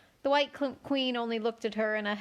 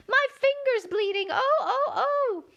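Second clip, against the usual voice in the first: very high, loud steam-whistle scream; screaming Queen; 6 dB louder than usual. A narrator's reading. Queen screams over her bleeding finger, very high and loud. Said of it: "Her screams were so exactly like the whistle".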